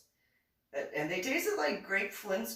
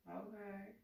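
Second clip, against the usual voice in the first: tiny, high, soft voice; meek reply; 6 semitones higher, 16 dB quieter than usual. Retold or imitated. Retelling own answer to police officer, meek. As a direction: shrink it to a small squeak.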